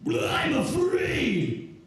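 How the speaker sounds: high, drawn-out shouted wail; stand-in impression voice. Texting an ex's panicked message to the audience, high drawn-out wailing voice. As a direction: stretch it into a very high, slow cry. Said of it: "I can't do an impression of her, so that's the voice".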